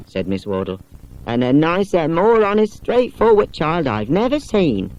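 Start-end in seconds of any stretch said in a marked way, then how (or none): 1.3-4.9 lightly voiced companion, higher, slowing at the end; a companion warmly praising the girl; fond praise. Miss Wardle praises child.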